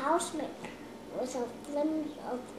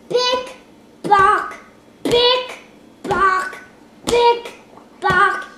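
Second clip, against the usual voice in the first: loud high clomping sounds; loud stomping hooves; 6 semitones higher, 13 dB louder, much slower than usual. Acted. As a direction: stomp it out heavily. Big goat stomps.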